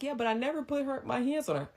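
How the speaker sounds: high whining plea; whiny fake crying. The man to her mom, mock-whiny high plea.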